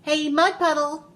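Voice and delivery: loud, high challenge; yelling. A narrator's reading. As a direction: call the puddle out loudly. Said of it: "so she yelled".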